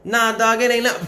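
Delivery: tough brush-off. Retold or imitated. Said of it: "I was like".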